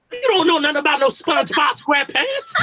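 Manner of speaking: high, loud, badgering challenge; obnoxious old head. Comedian as future old head defending his cartoons, loudly obnoxious. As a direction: mimic your dad's old-head hollering. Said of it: "I'm gonna be an old head too".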